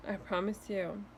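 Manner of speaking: ordinary talk, nothing marked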